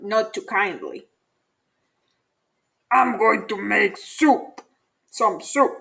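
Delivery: a hint of a gruff grumpy varmint; not too kindly, grumbling; scruffy varmint. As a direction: varmint grumbling at a curious bunny. Varmint character voice.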